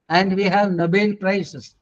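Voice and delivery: ordinary talk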